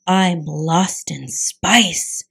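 slow dazed words; drawn out in dismay. Acted. Alone and lost.

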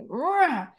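high, mock angry growl; child venting frustration with a growl; frustrated growl. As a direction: let out a kid's frustrated grr.

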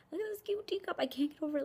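high, airy squeal of adoration; high-pitched cooing delight; cutesy gushing. Real, not acted. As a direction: gush over something tiny and cute. Gushing over a cute teacup.

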